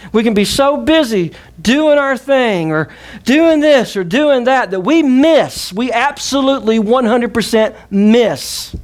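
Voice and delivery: high, slow, emphatic preaching; impassioned preaching. Acted. Preacher warning the busy congregation, high and slow. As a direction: stretch every word as you climb.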